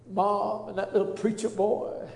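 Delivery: normal talking voice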